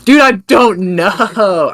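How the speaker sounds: high, drawn-out groan of indecision; agonized indecision. Real, not acted. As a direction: stretch it out, torn between two picks. Agonizing over a hard choice.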